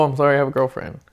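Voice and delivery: usual speaking voice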